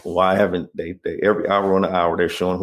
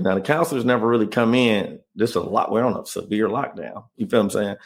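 ordinary delivery

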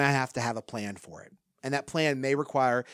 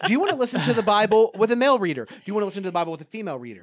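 fast, rising, piling up options with higher, louder peaks; excited rapid list; 6 semitones higher, 8 dB louder, much faster than usual. Real, not acted. Pastor to listeners, mocking the endless Bible options, rapid and excited. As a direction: rattle them off faster and faster like an excited salesman.

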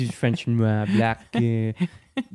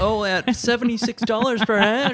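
lightly higher gossip voice; mock shopper; 11 semitones higher, 3 dB louder than usual. Cohost joining the shopper bit, higher mock voice. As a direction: play along as a catty shopper.

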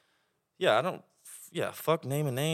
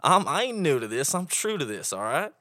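slow, higher, louder cowboy swagger; hammed-up Oklahoma drawl; 7 semitones higher, 5 dB louder, slower than usual. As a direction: play up the Oklahoma cowboy accent. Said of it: "this is where the accent comes out".

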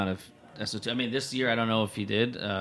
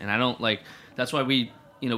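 ordinary delivery

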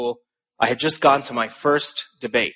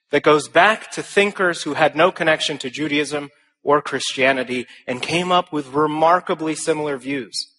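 ordinary delivery

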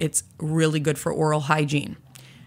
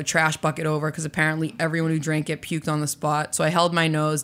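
ordinary delivery

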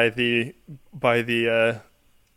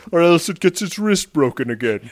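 menacing Buffalo Bill style taunt; creepy Buffalo Troi voice; 4 semitones higher, 4 dB louder than usual. Pretend. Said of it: "Buffalo Troi voice is so creepy".

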